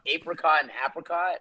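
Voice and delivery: "apricot" first with long A, then "apricot" with short A; two pronunciations contrasted. Shown as a demonstration. Guest giving word examples, contrasting two ways of saying "apricot". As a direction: say apricot as AY-pricot, then as AP-ricot. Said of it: "other words that are like that".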